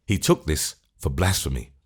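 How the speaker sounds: usual speaking voice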